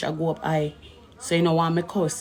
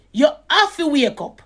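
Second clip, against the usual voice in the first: ordinary talk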